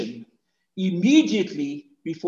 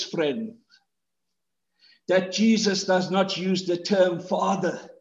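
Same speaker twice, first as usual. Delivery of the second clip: normal talking voice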